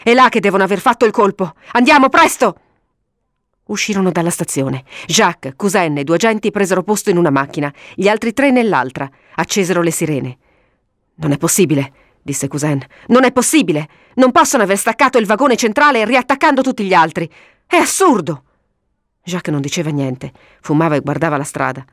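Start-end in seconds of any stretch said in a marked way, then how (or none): 0.0-2.6 higher, building to a loud command; rally them to hurry; shouted urgency, rising in pitch; urgent shout. A narrator's reading. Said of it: "urlò Cousin". 13.1-18.4 high-pitched, indignant protest; heated incredulous outburst; agitated disbelief. A narrator's reading. Same words repeated in agitation.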